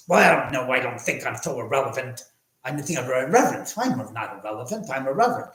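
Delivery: affected, lisping comic self-defence; Jiminy Glick. Acted. Host answers as the accused character, in a lisping, affected impression. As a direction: swoop through it like a pompous character. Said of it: "He would say the word".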